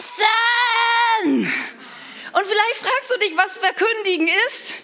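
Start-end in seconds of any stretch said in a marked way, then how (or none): 0.0-1.5 loud sung call down the hall. Retold or imitated. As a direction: call everyone to dinner. Daughter calls family to eat.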